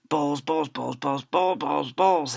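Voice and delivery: usual speaking voice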